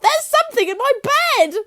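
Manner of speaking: very high, loud terrified shriek; panicked scream. A narrator's reading. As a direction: squeal it in terror. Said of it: "screamed Missus Twit".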